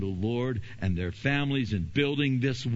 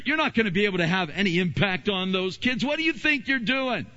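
taunting enemy; 8 semitones higher than usual. Retold or imitated. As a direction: shout them down with scorn. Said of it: "And the enemy goes".

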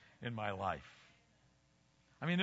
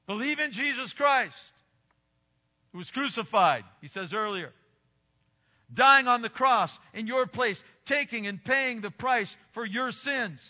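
loud, high-pitched gospel proclamation; forceful preaching; 6 semitones higher, 8 dB louder than usual. Real, not acted. Preacher urging congregation to believe in Christ, forceful proclamation. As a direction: drive each phrase home from the pulpit.

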